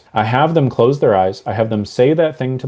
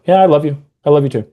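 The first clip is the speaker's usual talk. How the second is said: higher, louder and quick; dismissive tone; 4 semitones higher, 4 dB louder, much faster than usual. Shown as a demonstration. Spouse answers offhandedly, brushed off. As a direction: toss it off like a chore. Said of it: "Same words, different tonality".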